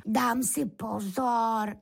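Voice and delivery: slow, high lamb voice; little lamb's voice. A narrator's reading. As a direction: promise it slowly in a high little voice. Beránek odpovídá bíčkovi. (pomalý vysoký hlásek beránka)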